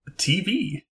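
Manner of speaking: "T V" pitched high and drawn out, oddly; odd-sounding TV. Real, not acted. Host repeats the word to prove it sounds fine, odd-sounding TV. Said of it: "does that sound weird to you".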